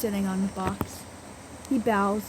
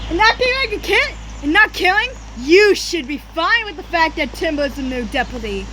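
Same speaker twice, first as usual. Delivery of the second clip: loud, high furious snarl; angry loud snarl; 8 semitones higher, 7 dB louder than usual. A narrator's reading. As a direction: snarl it in anger. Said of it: "he snarled".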